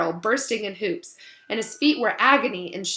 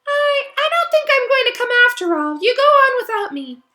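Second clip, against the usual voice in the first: put-on much higher, reluctant girl's voice; high-pitched voice; 8 dB louder than usual. A narrator's reading. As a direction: pitch it way up, hesitant. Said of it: "A high-pitched voice answered him".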